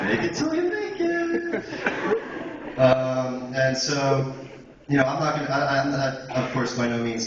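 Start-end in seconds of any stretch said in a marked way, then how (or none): 0.0-2.8 drawn out slowly and high through laughter; laughing. Real, not acted. Joking after drinking line.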